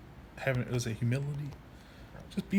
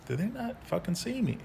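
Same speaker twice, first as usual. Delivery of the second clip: higher pitched, incredulous; exasperated disbelief; 8 semitones higher than usual. Retold or imitated. Waiting patient venting at staff, pitched-up exasperated disbelief. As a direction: ask it like you can't believe you're invisible. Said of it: "I'm looking at 'em like".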